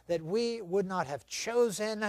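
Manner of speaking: usual speaking voice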